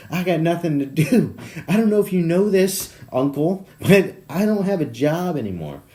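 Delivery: high, loud, giggly protest; laughing mock rant. Jobless nephew warning uncle he'll keep texting, laughing rant. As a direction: plead loudly through rising laughter.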